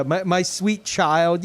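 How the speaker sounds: put-on talking to a small child, fondly and slowly. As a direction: draw it out like soothing a child. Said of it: "it comes off like that".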